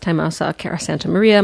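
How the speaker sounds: ordinary talk, nothing marked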